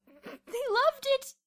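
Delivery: high, loud, giddy outburst; hysterical. A narrator's reading. Kathy telling how they won the contest, hysterical. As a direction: gush the triumph at full volume. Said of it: "still hysterical".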